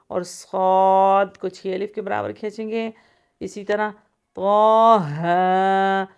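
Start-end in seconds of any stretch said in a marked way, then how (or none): 4.3-6.0 طٰہٰ recited loud and slow, heavy ط, long drawn vowels; heavy drawn recitation. Shown as a demonstration. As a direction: stretch طٰہٰ full-mouthed. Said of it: "طٰہٰ کو بھی موٹا پڑھیں گے".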